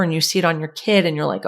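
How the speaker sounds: ordinary delivery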